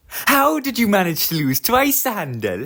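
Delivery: high, teasing engine voice; mock his double loss. Engine mocks Sir Handel.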